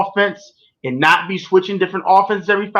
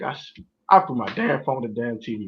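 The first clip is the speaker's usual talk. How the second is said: ordinary delivery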